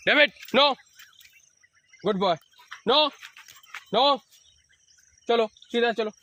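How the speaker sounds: high, loud no to the dog; stern voice to correct the dog; firm loud commands. Shown as a demonstration. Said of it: "जैसा ज़ोर से बोलोगे".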